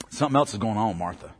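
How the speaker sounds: low, fast, hushed aside; hushed quick aside. Shown as a demonstration. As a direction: drop the voice and rush it like a secret. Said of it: "repeats the line".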